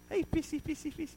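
high, soft sing-song call; coaxing, high-pitched and hushed; playful fish-calling. Acted. Acting Peter playfully on the water.

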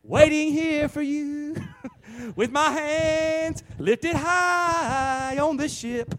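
high, loud, slow mock cry to Jesus; mock pleading. Preacher pretending to call Jesus from the ship, hammed-up and high. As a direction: ham up a timid plea from the deck. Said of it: "I say".

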